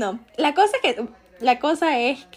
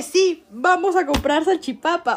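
higher, slower, happily drawn-out yes; eager agreement; 6 semitones higher, slower than usual. Retold or imitated. Drunk guest agreeing to go for salchipapas, higher and slow. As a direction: perk up at the promise of food. Y le dije.

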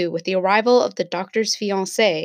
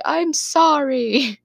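higher, slow, stretched sorry; drawn-out apology; 6 semitones higher, much slower than usual. Retold or imitated. Ray apologizing again to the team, slow and pleading. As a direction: drag the sorry out like a guilty whine.

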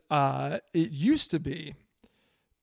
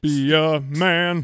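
macho voice; 5 dB louder, much slower than usual. Acted. Mock macho line.

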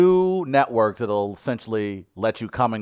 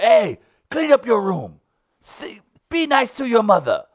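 higher stern grandfather scolding in a put-on accent; accidental Will Smith Concussion accent; odd accent; 11 semitones higher, 4 dB louder than usual. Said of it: "What is that accent?".

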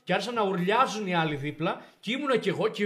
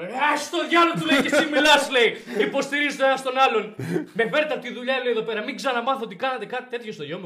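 louder, higher furious retold shout; 6 semitones higher, 8 dB louder than usual. Father shouting down the vice principal, loud. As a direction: cut him off, yelling.

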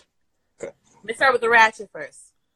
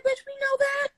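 high mock voice. Snapping at Katie in a high mocking voice. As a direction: keep the high squeaky pitch. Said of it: "I said".

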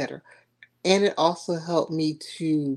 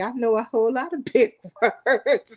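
laughing; 6 semitones higher than usual. Real, not acted. Laughing boast.

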